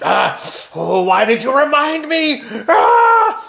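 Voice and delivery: loud, high exasperated yell; furious king shout. Acted. King reminded he has no gloves, high furious shout. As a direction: blow up at being reminded.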